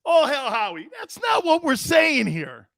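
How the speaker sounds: high, loud mock yelling of Howie's line; hollering it like the loud guy in the room; hammed-up high shout. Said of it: "He goes".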